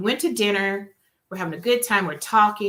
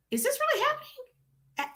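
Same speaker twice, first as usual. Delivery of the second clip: high, incredulous; can't believe her eyes; stunned disbelief; 5 dB quieter than usual. Retold or imitated. Stunned at friend.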